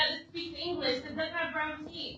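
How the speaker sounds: crying. Real, not acted. Mother crying in hospital.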